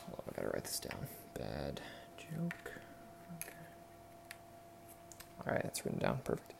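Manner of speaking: breathy whisper while writing; a hushed aside to yourself. Real, not acted. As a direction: murmur to yourself, barely audible.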